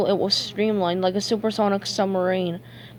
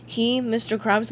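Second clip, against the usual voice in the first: ordinary delivery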